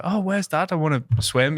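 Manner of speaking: put-on high-pitched eager kid voice; child asking. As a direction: play a kid looking for Dad.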